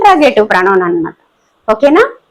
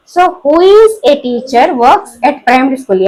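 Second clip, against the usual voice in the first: slow repeat; much slower than usual. Shown as a demonstration. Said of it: "example repeated for class".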